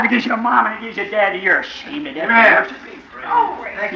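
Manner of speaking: usual speaking voice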